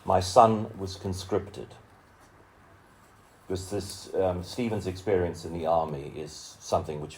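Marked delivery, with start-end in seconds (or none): 0.0-1.8 measured, rhythmic poem recital with lingering pauses; poetry reading. Acted. Reading a friend's poem aloud to an audience, solemn recital. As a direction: let each image land, unhurried and solemn.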